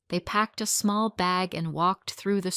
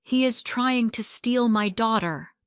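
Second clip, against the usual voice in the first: angry; 4 semitones higher, 6 dB louder than usual. A narrator's reading. Angry father orders villagers.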